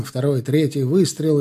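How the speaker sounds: ordinary delivery, nothing marked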